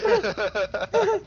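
high loud cackling laugh; gleeful laugh. Real, not acted. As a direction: laugh in triumph. Gloating laugh.

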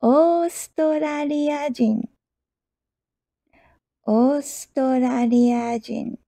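Australiajin drawn out syllable by syllable; slow teaching pace. Shown as a demonstration. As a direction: stretch Australiajin slowly for learners.

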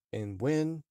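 ordinary delivery, nothing marked

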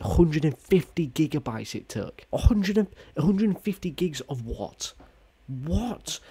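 high-pitched exasperated disbelief; incredulous outrage. Real, not acted. Gamer protesting a huge download that won't run, incredulous. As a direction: send the pitch way up in baffled protest.

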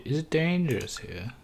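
usual speaking voice

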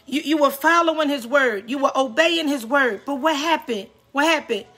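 impassioned exhortation, lifted above conversational talk; real preaching. Real, not acted. Preacher urges listeners not to miss their season, preaching. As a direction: press each point home in short bursts, with pauses to let it land.

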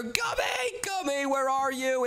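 high, searching call. Acted. Child looking for brother, calling out. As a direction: call high for someone elsewhere.

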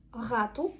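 ГАТУ starting with a throaty fricative R; guttural R. Shown as a demonstration. Teacher shows one option for mouse, guttural ГАТУ. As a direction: scrape the first sound of ГАТУ in the throat. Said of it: "або г".

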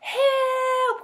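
high, loud cry for help; panicked cry. A narrator's reading. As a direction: yelp for help as a scared bear. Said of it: "cried the bear".

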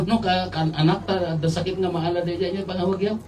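ordinary talk

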